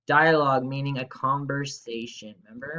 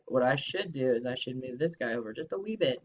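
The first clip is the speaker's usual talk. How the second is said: ordinary delivery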